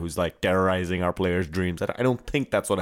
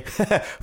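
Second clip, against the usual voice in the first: bursts of real laughter at his own pun; laughing at own joke; 11 semitones higher than usual. Real, not acted. Host laughing at his own soccer pun while telling it. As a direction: crack up mid-sentence.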